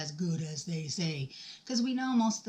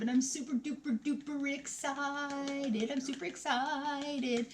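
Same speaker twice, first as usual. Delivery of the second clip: high, stretched-out singsong excitement; super excited; 6 semitones higher, slower than usual. Real, not acted. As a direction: draw out every excited word, high. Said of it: "And I'm getting excited".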